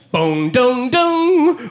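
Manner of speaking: slow sung chime bong dong dong; subway chime imitation. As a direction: mimic the metro announcement tones. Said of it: "It's like".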